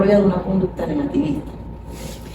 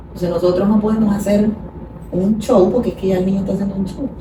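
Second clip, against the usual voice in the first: ordinary talk, nothing marked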